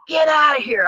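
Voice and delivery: loud, high shout of dismissal; retold yell. Yelling at ex-boyfriend Kane's voice to get out, loud and high. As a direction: yell it, pushing him away. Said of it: "I'm yelling".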